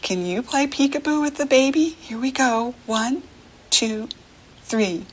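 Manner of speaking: usual speaking voice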